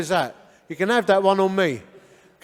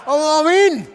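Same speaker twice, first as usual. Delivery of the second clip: loud, high mock enthusiasm; over-keen comic declaration; eager conversion; 8 semitones higher, 7 dB louder than usual. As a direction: belt it out like an instant convert. Imagined eager conversion, punchline.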